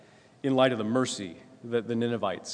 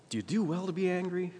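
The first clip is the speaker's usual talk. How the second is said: higher, gentle divine question; God gently probing his angry prophet; the Lord asking; 8 semitones higher than usual. Acted.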